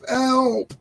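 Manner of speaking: hammed-up helpless wail; mock scream for help. Husband calling the wife to rescue him from the computer, mock scream. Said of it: "I just scream for the wife".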